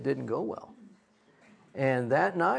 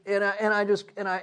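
ordinary talk, nothing marked

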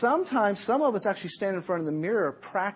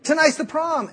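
much higher and louder, "Tonight's" hit hard; exasperated; 10 semitones higher, 8 dB louder than usual. Retold or imitated. Girl on the phone to her late date, exasperated. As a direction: throw it back at him in disbelief. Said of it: "Excuse me?".

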